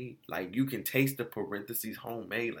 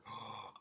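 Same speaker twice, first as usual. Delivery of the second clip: mock gasp; 15 dB quieter than usual. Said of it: "she like".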